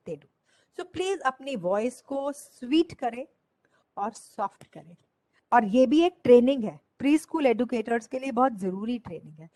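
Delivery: usual speaking voice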